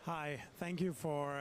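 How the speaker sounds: ordinary talk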